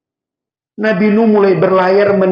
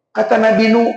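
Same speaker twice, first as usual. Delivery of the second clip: usual speaking voice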